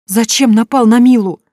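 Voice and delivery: high, loud accusing outburst; accusing cry. A narrator's reading. Sonya confronting her ex about Mila, loud cry. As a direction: fling the question at him.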